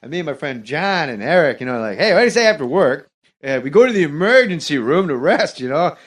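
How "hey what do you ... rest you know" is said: rambling, swooping comic delivery; loose, circling stand-up impression; Norm Macdonald impression. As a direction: keep meandering through the bit in character. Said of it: "a Norm impression is you have to ramble".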